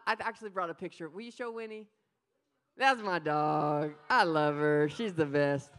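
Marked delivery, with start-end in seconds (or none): 2.8-5.7 doting. Real, not acted. Showing picture of his dog.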